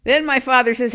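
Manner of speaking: ordinary delivery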